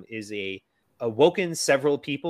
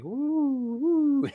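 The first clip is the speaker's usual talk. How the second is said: very high whooping woo; Michael Caine woo. Retold or imitated. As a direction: whoop like you're flying on wires. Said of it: "Michael Caine going".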